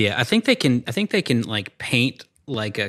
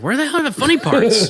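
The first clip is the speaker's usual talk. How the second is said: high, loud mock outburst through a laugh; hammed-up frustration at a dull movie; exasperated yell; 14 semitones higher, 5 dB louder than usual. Friend retelling his own reaction, exasperated laughing yell.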